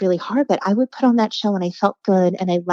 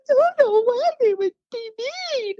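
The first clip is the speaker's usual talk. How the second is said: put-on bawling child. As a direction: wail it through tears like a heartbroken kid. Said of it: "I am bawling hysterically".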